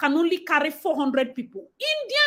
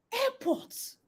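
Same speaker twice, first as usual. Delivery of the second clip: incredulous; 9 semitones higher, 8 dB quieter than usual. Real, not acted. Disbelief repeating the word.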